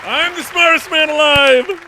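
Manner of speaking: loud triumphant boast; gloating. Real, not acted. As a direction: crow about your win. Gloating after winning.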